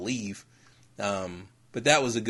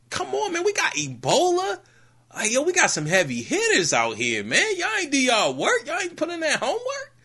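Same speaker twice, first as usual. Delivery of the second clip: high, incredulous ribbing; mocking the aliens who skipped their homework, high disbelief; mock scolding; 9 semitones higher than usual. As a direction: roast them like clueless kids who skipped class. Mock lecture to clueless aliens.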